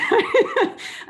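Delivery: high, loud burst of laughter; amused laugh. Real, not acted. Speaker joking about hearing her bio read out, amused laugh. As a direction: laugh out loud at your own joke.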